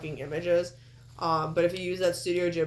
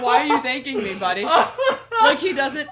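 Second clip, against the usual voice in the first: louder, higher, talking through laughter; real laughing; 3 semitones higher, 5 dB louder than usual. Real, not acted. Host questioning the wrestler's odd thanks, laughing hard. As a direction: keep explaining while cracking up.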